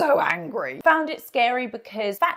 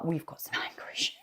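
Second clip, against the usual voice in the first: whispered under the breath with a little laugh; sarcastic whisper; 6 semitones lower, 10 dB quieter, faster than usual. Real, not acted. To the viewers after the angry clip, a whispered wry aside. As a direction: let it slip as a wry aside, barely voiced.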